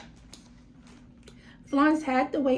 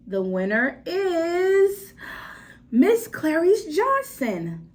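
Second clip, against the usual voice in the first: slow, louder, higher showman reveal; judge's announcement; 5 semitones higher, 5 dB louder, much slower than usual. Acted. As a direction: draw out the winner's name.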